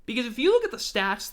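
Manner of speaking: ordinary talk, nothing marked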